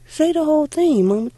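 put-on a little girl tugging at her mother's attention; young girl pleading. Little girl asking her mother to say the whole psalm, eager and high.

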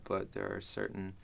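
ordinary delivery

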